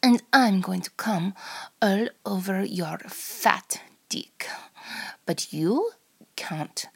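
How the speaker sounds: put-on sultry, drawn-out Russian accent; put-on Russian accent, often half-whispered. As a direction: play a teasing, menacing Russian seductress. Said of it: "in a Russian accent".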